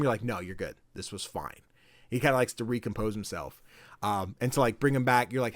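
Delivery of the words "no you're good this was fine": low, soft, soothing reply; imitated reassurance. Player reassuring the worried character, low and gentle. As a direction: drop down soft to calm someone.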